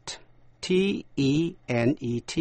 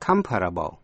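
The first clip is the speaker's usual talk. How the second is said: comparable with a strong "com"; stress on first syllable; 6 semitones lower than usual. Shown as a demonstration. Teacher sums up the word family, comparable stressed on the first syllable. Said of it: "nhấn vần đầu".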